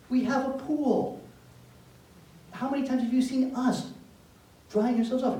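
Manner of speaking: a mother who can't believe it; incredulous mom. Retold or imitated.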